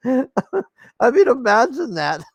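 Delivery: high, incredulous laugh through the words; laughing in disbelief. Real, not acted. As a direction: laugh it out, can't believe it.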